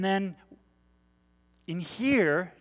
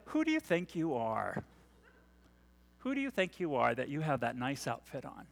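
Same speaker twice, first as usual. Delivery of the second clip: hushed inner critic; 4 semitones lower, 8 dB quieter than usual. Retold or imitated. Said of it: "that voice".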